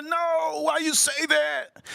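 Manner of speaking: very high, drawn-out squeal; mock wailing protest. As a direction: imitate a friend wailing in protest, slowly. Repeats friend's alarmed protest.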